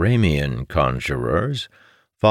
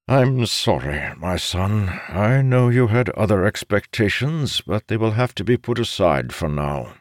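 apologetic father; 6 semitones higher than usual. Acted. Voices the father consoling his son.

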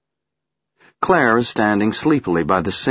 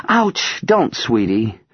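gentle; 4 semitones higher, slower than usual. Acted. Mother hurt, gentle.

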